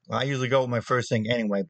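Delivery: ordinary delivery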